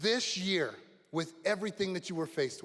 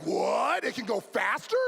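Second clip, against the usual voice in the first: loud, higher mock surprise; amazed kids; 4 semitones higher, 4 dB louder than usual. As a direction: imitate kids' incredulous delight. Kids amazed at adults' plan.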